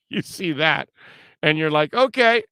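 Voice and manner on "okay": sarcastic okay. Retold or imitated. Mock reaction to Kelly.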